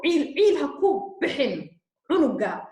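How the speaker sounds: emotional plea. Real, not acted. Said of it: "I am very emotional about it".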